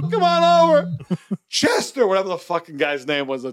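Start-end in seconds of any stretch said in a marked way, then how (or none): 0.0-0.9 high, loud frantic cry for help; panicked plea. Retold or imitated. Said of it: "She calls, like me calling you". 1.5-2.1 high frantic shout of a name; panicked call. Retold or imitated. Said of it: "She calls, like me calling you".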